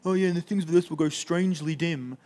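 lightly voiced casual teen slang; mock teenager. Preacher mocking the hymn as a teenager's lyric, lightly hammed-up teen voice. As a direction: toss it off like a bored teenager. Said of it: "one of the teenagers from Friday Night wrote this song".

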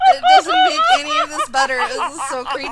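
laughing through the words; can't stop laughing, high and helpless; hysterical laugh. Real, not acted. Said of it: "I'm cry laughing right now".

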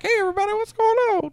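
high, fast squeaky voice; squeaky cartoon voice. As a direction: chirp it out tiny and fast. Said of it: "be like".

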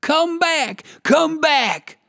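high, loud desperate call; crying out. A narrator's reading. Trapped wolf shouts after the rabbit, high and loud. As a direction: yell after the fleeing rabbit. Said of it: "cried the wolf".

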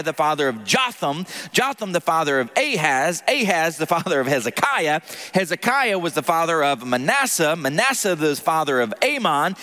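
steady formal scripture reading. Acted. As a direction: read the list like a reverent liturgy. Reads genealogy aloud.

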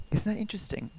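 hushed, breathy aside to the room; whispered aside. Real, not acted. Pastor whispering an aside to his congregation, hushed. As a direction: drop to a confiding whisper.

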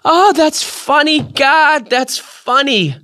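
high imitated voice recovering from a laughing fit; high, winded delight, slowing at the end; sighing after laughter. A narrator's reading. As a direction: sigh it out high, spent from laughing. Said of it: "she would sigh, breathing heavily, and say".

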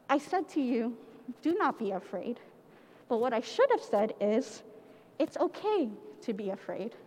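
soft, gentle angel voice; softly. Acted. Angel Gabriel comforting Mary, soft and gentle. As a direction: quietly reassure a scared girl. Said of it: "looked at Mary softly".

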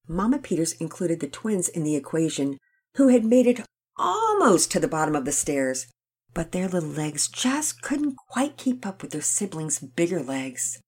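ordinary delivery, nothing marked

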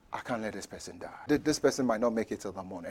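low, hushed pleading under the breath; very soft prayer. Retold or imitated. Pastor praying for a dying church member, very softly. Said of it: "he said very softly".

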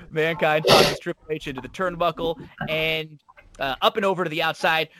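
usual speaking voice

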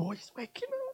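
acting out a hushed, delighted parent; hushed father. Father watching his baby wake up, hushed.

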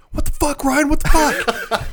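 high-pitched imitation of a furious shout; mock outraged yelling. Imitating a roommate woken by peeing, mock outraged yelling. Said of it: "this is what I hear".

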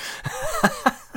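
very high squeal of laughter. Real, not acted. Laughing at own trip story.

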